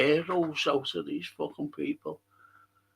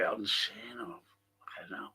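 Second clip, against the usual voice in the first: whispered aside; 9 semitones lower, 13 dB quieter than usual. Real, not acted. Trailing aside to himself.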